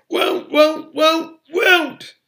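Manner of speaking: very high, loud elf scream; risen to a scream. A narrator's reading. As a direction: screech the word over and over. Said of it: "had risen to a scream".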